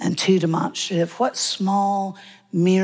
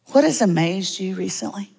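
ordinary delivery

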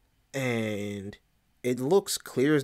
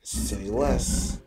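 altered creature voice, brief agreement; Diablo. Acted. Diablo agrees to play along, in an altered creature voice. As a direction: agree in an otherworldly voice. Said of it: "So I speak to Diablo".

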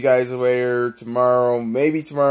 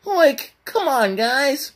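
high, strained cartoon slacker pitch; Shaggy; 10 semitones higher than usual. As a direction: appeal to viewers as a high-voiced cartoon sidekick. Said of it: "Shaggy wanna say something".

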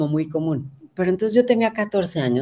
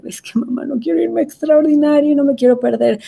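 higher pleading plea, rising into a whine; whiny pleading son; 9 semitones higher, 4 dB louder than usual. Retold or imitated. Brother begging mom not to send him to summer exams, whiny. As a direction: beg like a scared boy who failed. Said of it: "mi hermano así".

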